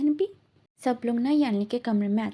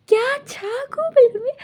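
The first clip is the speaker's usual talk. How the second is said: shocked shout; 13 semitones higher, 9 dB louder than usual. Retold or imitated. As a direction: scream it out in horror at the knife. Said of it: "चिल्ला के बोलती कि".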